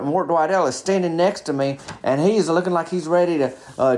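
usual speaking voice

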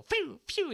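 high whistly zooming noise; quick, high-pitched whooshes; imitated whoosh. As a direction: mimic cars flying past. Imitates patrol cars zooming past.

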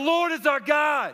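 high, loud proclaiming shout; shouted call to the congregation; with chutzpah. Real, not acted. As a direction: call it out with guts to the crowd. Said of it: "with some guts".

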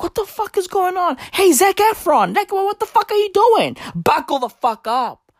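a disbelieving father mocking his son; outraged dad. Father scolding son again.

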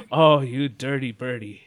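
lightly voiced parent teasing. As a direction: tease him like a nosy parent.

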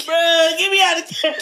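high, loud laughing plea; cry-laughing. Real, not acted. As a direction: laugh until tears come.

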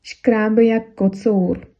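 teacher modelling a Czech phrase; slow example phrase. Shown as a demonstration. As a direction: spell out «Шкрабла мне кочка» word by word for learners. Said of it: "а чехи говорят".

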